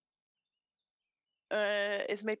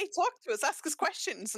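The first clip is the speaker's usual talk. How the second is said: eager call; 8 semitones higher than usual. Retold or imitated. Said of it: "We were like".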